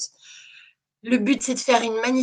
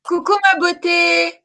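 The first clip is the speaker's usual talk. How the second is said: high, louder, gushing hello; excited greeting; 10 semitones higher, 6 dB louder, much slower than usual. Real, not acted. Welcoming her guest Esmeralda onto the live, excited and high. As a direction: squeal a delighted welcome.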